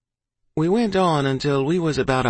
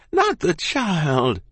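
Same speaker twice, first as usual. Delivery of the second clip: higher, slow, drawn-out protest; crying out; 5 semitones higher, much slower than usual. A narrator's reading. Mother protests for her child, crying out. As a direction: cry out in a mother's alarm. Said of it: "Ma cried".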